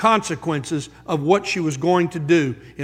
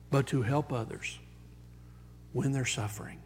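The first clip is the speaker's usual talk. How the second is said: ordinary delivery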